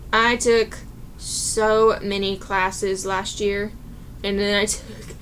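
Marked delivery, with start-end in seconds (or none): none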